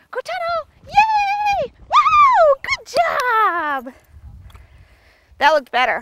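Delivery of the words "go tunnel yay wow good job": high, loud cheering for the dog, rising to a squeal; excited dog praise. Real, not acted. Trainer cheering her dog through the tunnel, squealing with delight.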